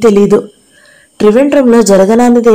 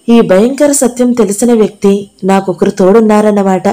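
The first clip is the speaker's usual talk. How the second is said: plain reading voice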